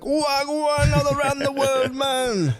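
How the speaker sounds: high, slow, hammed-up Jamaican accent; mock Jamaican. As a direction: stretch it out in a goofy Jamaican voice. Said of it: "unironically be like".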